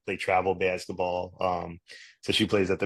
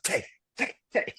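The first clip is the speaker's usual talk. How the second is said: wife's hushed warning; 11 semitones higher than usual. Retold or imitated. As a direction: hiss his name like a worried wife. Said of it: "you could hear my wife in the back".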